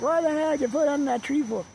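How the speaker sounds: high put-on voice of disbelief; high mock-indignant question; indignant onlookers. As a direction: mimic baffled people up high. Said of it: "people are gonna be like".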